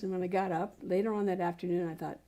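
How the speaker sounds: usual speaking voice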